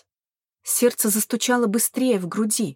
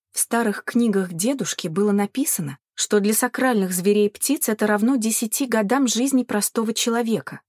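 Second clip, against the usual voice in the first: plain reading voice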